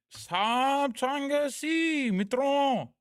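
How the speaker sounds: high, very slow, loud oratorical delivery; Modi impression. Chat demands Modi, high slow speechmaker voice. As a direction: stretch it out like a rally speech to the nation. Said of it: "That's Modi".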